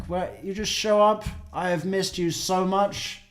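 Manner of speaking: high, loud, delighted reunion squeal; excited greeting. Real, not acted. Greeting Sally after weeks missing, high and excited. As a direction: gush at her, way up in the voice. Pet Sally suddenly shows up.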